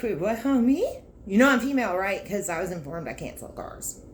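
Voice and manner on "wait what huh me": stunned disbelief. Retold or imitated. Stunned by the interview call.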